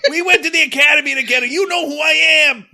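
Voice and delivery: fast, high, hammed-up shouting; over-the-top acted outburst; movie-scene yelling. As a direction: yell it like a desperate movie cop demanding recognition. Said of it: "is yelling at Anthony Anderson".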